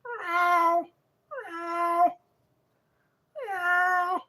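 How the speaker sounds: high, drawn-out meows; imitated cat yowling; male cat in heat. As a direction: yowl like a tomcat in heat. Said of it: "That sounds like a male cat in heat".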